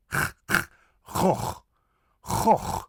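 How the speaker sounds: rasped throat scrape on g and Gogh; voiceless guttural g, then "khokh" for Gogh; harsh G. Shown as a demonstration. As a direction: scrape both ends of Gogh. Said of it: "the harsh G".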